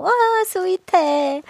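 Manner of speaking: slow, high cooing. Real, not acted. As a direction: coo over something sweet. Reacts to cat story.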